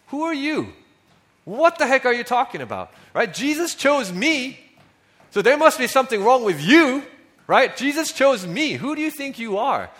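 imagined Zacchaeus scoffing at the grumbling crowd, high and loud; bitter retort. Pretend. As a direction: play a defensive rich man lashing back. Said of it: "imagine someone like Zacchaeus responding to the grumbling".

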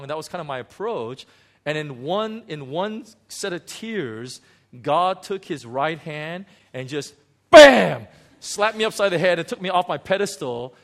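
ordinary talk, nothing marked

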